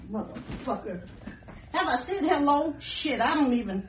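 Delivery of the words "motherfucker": muttered curse. Real, not acted. Quiet seething curse.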